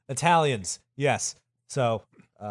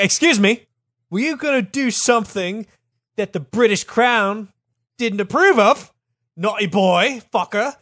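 high, loud British scolding; outraged, bad British accent; angry British accent; 8 semitones higher, 5 dB louder than usual. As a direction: tell him off in a hammed-up British accent. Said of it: "I do the incorrect accent".

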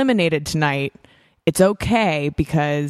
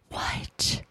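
whispered disbelief; 11 dB quieter than usual. Retold or imitated.

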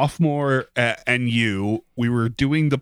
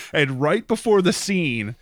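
ordinary talk, nothing marked

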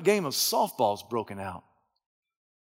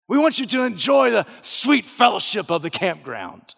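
jovial camp host; 7 semitones higher, 8 dB louder than usual. Acted. Camp man figure voice.

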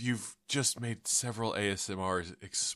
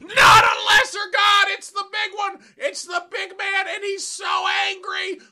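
doomsday hog wail; 9 dB louder than usual. Acted. Dying hog warning everyone of God, shrieking wail. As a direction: preach doom in a shrieking wail.

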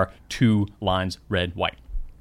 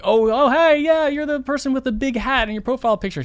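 much higher, fumbling small talk; awkward eager guess; 13 semitones higher than usual. Retold or imitated. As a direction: blurt it out, trying too hard. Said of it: "in my awkwardness".

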